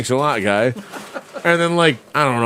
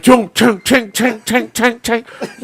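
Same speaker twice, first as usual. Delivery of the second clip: imitated metallic clinking; 6 semitones higher, 4 dB louder, slower than usual. Storyteller imitates running in steel shoes, metallic clinking. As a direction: sound out metal braces clanking on the run. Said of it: "come out of nowhere like".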